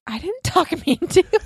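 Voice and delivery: high, laughing protest through giggles; amused mock protest, breaking into laughter. Mom retelling denying, laughing.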